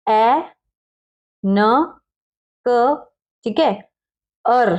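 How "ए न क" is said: ए, न, क as separate sounds; sounding out. Shown as a demonstration. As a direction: break anchor into ए, न, क with pauses. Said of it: "देखिए ए N C". (ए, न, क अलग-अलग बोलकर)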